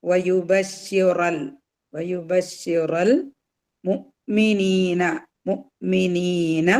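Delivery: slow വയുബശ്ശിറൽ with ra going straight into l; വയുബശ്ശിറൽ മുഅ്മിനീന, measured teaching recitation; joined recitation. Shown as a demonstration. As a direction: draw out മുഅ്മിനീന after a catch on mu. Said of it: "റാ കഴിഞ്ഞിട്ട് ലാം സുക്കൂനിലോട്ടാണ് വരിക".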